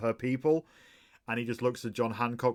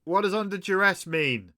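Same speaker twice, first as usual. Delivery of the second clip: high, projected megaphone query; amplified guard's puzzled question; 8 semitones higher, 4 dB louder than usual. Acted. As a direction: call the question out as through a megaphone. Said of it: "the voice on the megaphone says".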